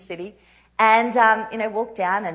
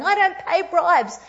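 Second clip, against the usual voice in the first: higher, louder, slow and defiant; firm refusal; 8 semitones higher, 7 dB louder, slower than usual. Retold or imitated. Traveller refusing to pay officials a bribe, slow and defiant. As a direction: spell it out to the officials, unbending.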